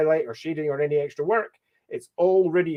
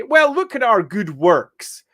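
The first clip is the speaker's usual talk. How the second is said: slower, higher, louder, the grand host pointing out charity; smug duke showing off; 6 semitones higher, 7 dB louder, slower than usual. Retold or imitated. The Duke boasting to a visiting dignitary, in a slow, raised, pompous voice. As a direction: play the lord proudly showing visitors his good works. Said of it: "they can say".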